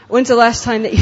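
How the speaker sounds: loud, half-laughing burst; laughing shout. Real, not acted. Preacher to congregation, laughing loud.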